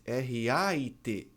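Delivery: ordinary talk, nothing marked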